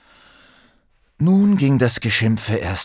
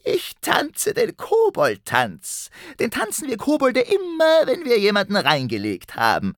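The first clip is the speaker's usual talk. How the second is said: gleeful goblin. Acted. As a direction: crow with delight like a goblin. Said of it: "Der Kobold krähte vor Vergnügen".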